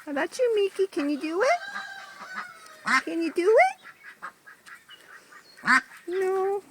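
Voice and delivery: high coaxing voice to a pet. Real, not acted. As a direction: coax her gently, high pitched. Coaxing duck Miki.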